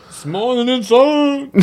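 high, drawn-out put-on voice; mocking echo. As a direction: echo it slowly in a silly high voice. Mocking echo of partner's phrase.

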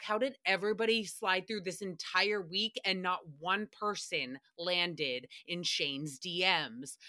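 normal talking voice

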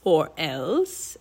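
low, questioning villain echo; mocking Raven King. A narrator's reading. Raven King echoes Annie's threat, low and mocking. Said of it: "he asked in a raspy voice".

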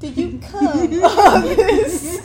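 slow, loud, laughing question; laughing disbelief. Real, not acted. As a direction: laugh through it, drawn out. Teasing a friend, laughing.

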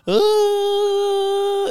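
high squealing mock laugh, loud; imitating his laugh. Imitating Scotty Barnes laughing on a Zoom call, high squeal. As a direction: squeal it out like a goofy player on a call. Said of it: "'cause he's, like, laughing".

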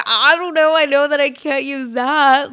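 loud, panicked insistence; loud mock panic of a schoolgirl. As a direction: raise the voice in alarm. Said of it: "So I was like".

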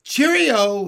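high, loud chirpy farewell; starling bidding a perky farewell; perky cheerio. A narrator's reading. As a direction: sing out a perky goodbye. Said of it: "he said perkily".

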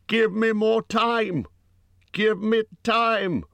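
high, drawn-out panicked cry; shout out. A narrator's reading. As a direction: cry out slowly, high and loud. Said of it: "He wanted to shout out".

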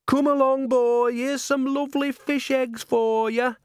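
put-on very high, sweet baby talk; coaxing a pet. A narrator's reading. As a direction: draw the cat out with slow, high cooing. Nanny coaxes her cat out.

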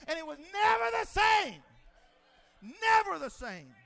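fiery pulpit cry; preaching shout. Real, not acted. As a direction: hammer the point home. Preaching peak.

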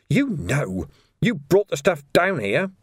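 high, put-on whining voice; flustered prisoner accusing his captor; agitated protest. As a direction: insist in high-pitched frustration. Ferret protests to Vimes.

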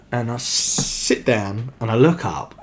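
ordinary delivery

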